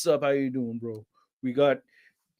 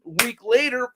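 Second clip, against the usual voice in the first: ordinary delivery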